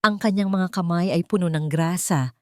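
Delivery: plain reading voice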